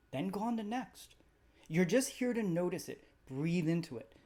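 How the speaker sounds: calm, even meditation guide voice; guided meditation. Acted. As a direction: gently lead the listener through the body scan. Leads a guided body scan.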